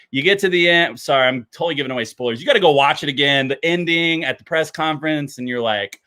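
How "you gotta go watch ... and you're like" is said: ordinary delivery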